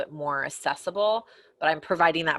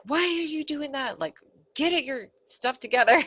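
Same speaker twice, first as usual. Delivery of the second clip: impatient scolding; 10 semitones higher than usual. Retold or imitated. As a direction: imitate someone telling you off. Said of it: "someone who's like".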